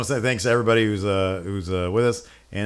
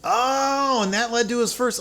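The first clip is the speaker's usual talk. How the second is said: high, drawn-out "oh" of realisation; sudden realisation; 12 semitones higher than usual. Real, not acted. Host learns the trivia answer he missed, sudden high realisation. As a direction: let the penny drop, voice leaping high.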